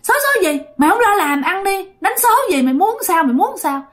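high-pitched, rapid-fire scolding; acted indignant telling-off; angry scolding. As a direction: berate him as an irate spirit. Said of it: "tán mặt anh bạn nói rằng".

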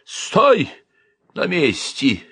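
high-pitched opening command; desperate cry. A narrator's reading. Uncle dashes to the stove, desperate cry. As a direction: halt someone in desperation. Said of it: "отчаянно крикнул он".

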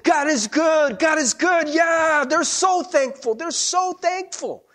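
loud, high-pitched jubilant cheer; celebrating. Acted. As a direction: whoop it up like an elated crowd. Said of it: "they're celebrating".

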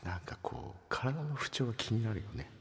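soft unvoiced whisper. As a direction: breathe it like a secret. Whispered segment.

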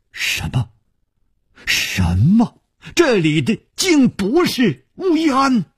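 hushed 'what' rising to a high exclamation; astonished disbelief. A narrator's reading. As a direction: start stunned, then burst out incredulous and high. Astonished to learn wrong nunnery.

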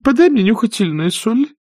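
put-on high, breathless old aunt babbling; faint babble. A narrator's reading. Aunt Pittypat babbles feebly to her maid. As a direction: flutter out a weak plea for salts. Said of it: "пролепетала тётушка Питтипэт".

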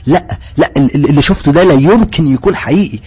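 panicked denial. Acted. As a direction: stutter in terror, refusing to believe. Panicked denial after the explosion.

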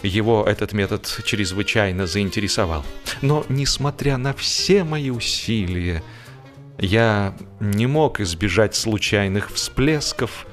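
ordinary delivery, nothing marked

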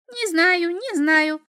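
high, bouncy imp. A narrator's reading. Imp jumping about.

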